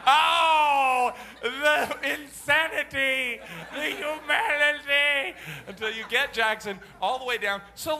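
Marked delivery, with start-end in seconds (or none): 0.1-5.4 slow, loud hammed-up lament; higher, louder theatrical despair; mock melodrama. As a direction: wail it like a tragedian. Mock melodrama about son collapsing.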